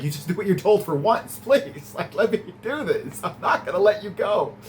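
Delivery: lightly voiced Giles begging her to stay back; mock pleading Giles.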